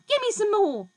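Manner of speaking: high, loud greedy cry; screechy demand. A narrator's reading.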